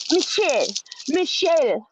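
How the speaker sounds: high imitation of a European calling the name; a hammed-up faux European accent; mock French accent. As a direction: mimic her fake French-sounding accent. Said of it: "Is there somebody French or European".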